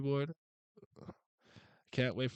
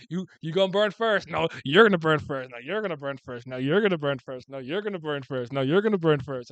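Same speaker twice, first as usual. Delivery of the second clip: voicing a childish squabble; 10 dB louder than usual. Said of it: "They said".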